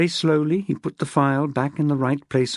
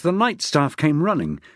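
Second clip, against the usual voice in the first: usual speaking voice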